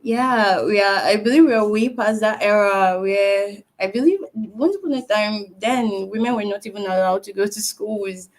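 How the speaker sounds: ordinary delivery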